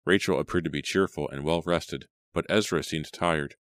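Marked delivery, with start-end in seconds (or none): none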